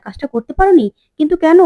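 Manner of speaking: shocked demand. Acted. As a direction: demand an answer in disbelief. Aunt shocked job not done.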